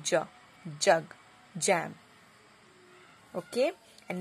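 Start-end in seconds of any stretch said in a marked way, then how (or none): 0.0-0.2 "ज" said as a j with a short open vowel; J letter sound. Shown as a demonstration. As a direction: sound out "ज", not the letter name J. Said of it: "J का phonetic sound क्या होता है".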